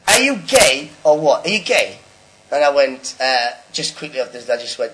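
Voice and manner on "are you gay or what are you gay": slow, loud, higher jeering yell; imitated heckler still heckling after ten minutes; mock heckler shout. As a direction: bark it out to rattle the comic. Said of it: "And then he goes".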